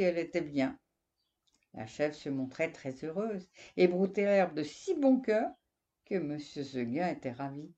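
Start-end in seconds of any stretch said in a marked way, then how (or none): none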